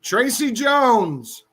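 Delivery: slow, drawn-out announcer reveal; winner announcement. Real, not acted. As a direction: build suspense for the winner.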